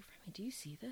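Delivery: whispered aside to a friend; secretive, astonished whisper; urgent whisper. Retold or imitated. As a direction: retell it in a hushed voice. Retold aside to friend at bar.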